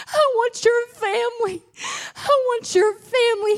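high, loud, crying demonic voice rising with each repeat; menacing spirit voice breaking into a cry. Retold or imitated. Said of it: "he says it three times".

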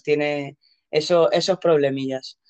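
normal talking voice

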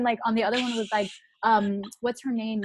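usual speaking voice

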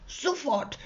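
high, held-back storybook yell; bossy creature in a soft mock yell; mock bellow. A narrator's reading. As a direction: order it high but soft. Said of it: "brüllt er".

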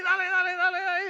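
put-on a frantic kid crying out; desperate child. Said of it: "Y el nene". (el niño grita desesperado, muy agudo)

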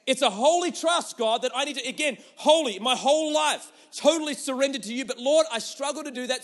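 high, loud impassioned prayer; fervent loud prayer. Acted. Believer praying aloud before the church, fervent loud prayer. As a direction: cry out to God in full voice.

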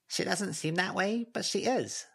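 high, small spirit voice; Snowdrop voice. A narrator's reading. Snowdrop answering Avery in a high voice. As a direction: pitch it up as the little Snowdrop.

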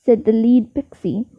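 usual speaking voice

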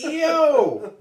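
loud high shout of delight. Retold or imitated. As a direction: yell it at the TV, thrilled. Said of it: "I'm like".

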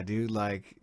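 ordinary talk, nothing marked

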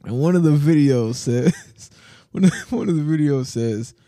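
real laughter breaking up the sentence; laughing. Real, not acted. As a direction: try to explain while cracking up. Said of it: "I'm laughing".

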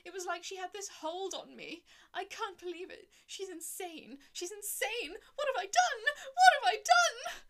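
high, loud, desperate sobbing confession; falling apart in tears, voice rising to a wail; blubbering. A narrator's reading. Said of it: "blubbering and stuttering like a bloody baby walrus".

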